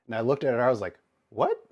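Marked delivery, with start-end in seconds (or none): 1.3-1.7 high, loud startled what; shocked what. Retold or imitated. To the agent about his birthday, a high startled what. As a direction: react in stunned disbelief.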